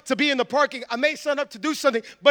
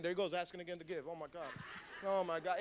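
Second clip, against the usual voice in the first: low, muttered grumble under the breath; grumbling; 6 semitones lower, 17 dB quieter, faster than usual. Acted. Churchgoer grumbling about being asked to give, low muttering. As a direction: mutter it quietly, fed up. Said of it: "don't do it grumbling and arguing".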